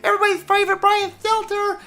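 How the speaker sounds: very high, squeaky mock voice; mocking announcement. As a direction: squeal the name in mockery. Mocking nickname for a TV host.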